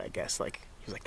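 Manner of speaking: normal talking voice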